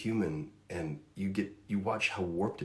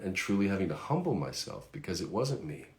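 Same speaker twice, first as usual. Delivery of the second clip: normal talking voice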